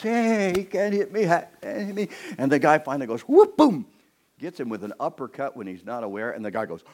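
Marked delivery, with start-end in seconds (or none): 0.0-2.1 high taunting boxer, mock swagger; strutting fighter teasing his opponent; cocky boxer. Strutting boxer taunting in the ring, mock cocky. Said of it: "going like this". 3.2-3.9 loud, high whoop-boom of a punch; punch sound effect. Retold or imitated. Preacher acting out the uppercut, loud whoop-boom.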